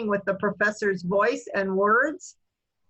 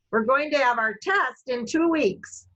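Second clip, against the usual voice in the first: emphasise "test" with a higher tone; higher pitch on test; 3 semitones higher than usual. Shown as a demonstration. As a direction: make "test" stand out by lifting its pitch. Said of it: "I'll say it again".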